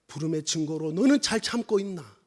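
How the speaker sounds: low, soft and weighty; God's hushed question. Retold or imitated. God asks the called missionary about enduring, hushed question. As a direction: let the question sink to near silence. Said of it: "하나님 정작 저에게 물어보시는 것은".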